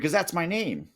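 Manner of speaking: firm, defiant reply, pitched high; bold answer. Retold or imitated. Talking back boldly to the ICE agent, defiant. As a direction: stand up to him on 'Cause that's my name. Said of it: "Although I didn't say it like that".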